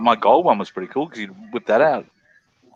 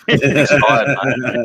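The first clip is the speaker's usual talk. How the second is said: mock Aussie clerk; 3 semitones higher, 6 dB louder, much slower than usual. Mock shop clerk to American.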